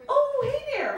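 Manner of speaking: high, sweetly polite greeting; chirpy overpolite hint at him; Midwest politeness. Retold or imitated. Overpolite nudge to date, high and sweet. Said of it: "with all of my Midwest politeness".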